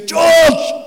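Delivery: loud, high drawn-out yell; shouting through. Retold or imitated. Friend at the door calling him, shouting. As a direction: bellow the name through a closed door. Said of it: "he's shouting through".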